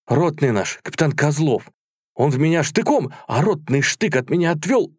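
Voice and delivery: high, animated soldier's tale; excited retelling. A narrator's reading. Soldier telling comrades how the captain saved him, excited. Soldier eagerly recounts his rescue.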